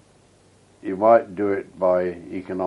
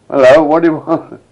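loud, higher challenge shouted back; calling back; 8 semitones higher, 12 dB louder than usual. Retold or imitated. Sentry answering the voice from the front, loud high call. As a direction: shout the reply into the dark.

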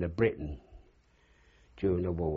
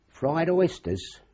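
relishing; 10 semitones higher, 5 dB louder, much slower than usual. Real, not acted. Savouring the memory.

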